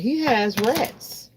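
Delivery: slow emphatic he has rats; dramatic reveal. Acted. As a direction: savor the shock of it.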